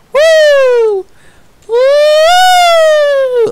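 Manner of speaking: very high, loud squealed woo; long falsetto cheer; celebratory whoop. Real, not acted. Celebrating viewer count.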